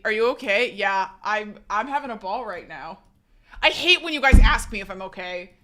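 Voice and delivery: normal talking voice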